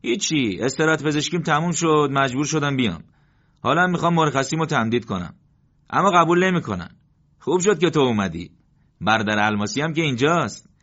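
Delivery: tired soldier pleading to his comrade; Moradi pleading. Acted. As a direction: ask for extended sick leave. Moradi voiced in story.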